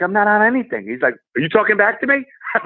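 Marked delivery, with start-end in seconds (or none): 0.0-0.9 high-pitched nervous denial; mock protest. Retelling a cop stop, high protesting reply. 1.1-2.3 a pitched-up take on a stern cop; mock cop challenge. Retold cop snapping at the stopped traveller, mock stern.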